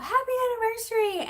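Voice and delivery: put-on high squealed child's cheer; little girl yelling a surprise; retold child's scream. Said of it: "Arya screams out".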